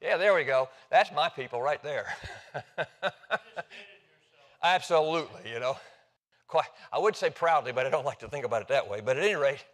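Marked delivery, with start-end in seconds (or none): 2.2-3.8 higher chuckle in short bursts; amused laugh. Real, not acted. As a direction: laugh along with the room. Laughing with the audience.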